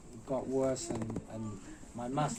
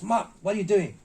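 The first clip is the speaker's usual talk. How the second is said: loud, high imitation of a scolding master; retold scolding shout; 6 semitones higher, 9 dB louder than usual. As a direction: berate the student loudly, mimicking the master. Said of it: "My master shouts".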